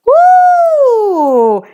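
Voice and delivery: high, loud whoop of delight; excited whoop. Real, not acted. Reader whoops as kettle five boils over, high excited woo. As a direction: let out a joyful "woo". Reader reacts to intense reading.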